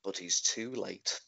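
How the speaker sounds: slow, low, soft voiceover line; documentary voiceover. Retold or imitated. The voiceover narrator announces the failure, slow and soft. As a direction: deliver it like a dry narrator, slowly and low. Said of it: "makes a comment of".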